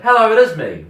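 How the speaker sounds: put-on high, loud New Zealand accent; very Kiwi accent. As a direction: imitate a Kiwi woman's bright greeting. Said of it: "Very Kiwi".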